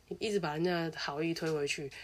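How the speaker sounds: ordinary talk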